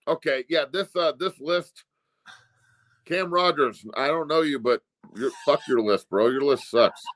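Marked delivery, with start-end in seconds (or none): none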